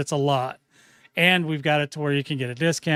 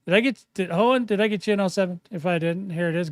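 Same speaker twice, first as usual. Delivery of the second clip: normal talking voice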